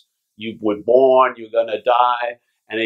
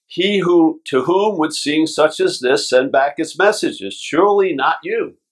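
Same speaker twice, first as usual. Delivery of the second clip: rising scripture reading; 4 semitones higher than usual. Acted. Reading from the Course.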